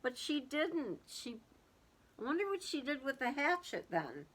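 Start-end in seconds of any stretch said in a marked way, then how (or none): none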